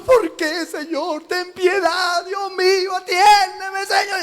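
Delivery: hammed-up lament; mock pleading prayer. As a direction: beg like a whining child.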